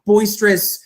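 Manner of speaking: ordinary talk, nothing marked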